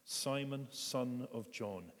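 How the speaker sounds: "son of John" slow, deliberate; Jesus calling him by his formal name; Sunday name. Acted. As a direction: lay out the full formal name slowly. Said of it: "Simon gets his Sunday name".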